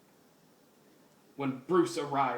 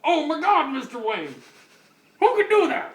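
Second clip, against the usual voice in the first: high, loud hammed-up horror; shocked shriek; 12 semitones higher, 11 dB louder than usual. Commissioner hearing of the murder, hammed-up high loud shriek.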